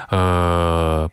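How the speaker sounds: a low, held "呃", a relaxed uh; breath from the belly. Shown as a demonstration. Teacher models the vowel for students, low held "呃". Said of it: "从丹田运出一股气息".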